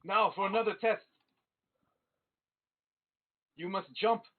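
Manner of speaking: monk master setting the next trial for the trainees; master announcing a test. Acted. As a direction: call out the trial like a drill master.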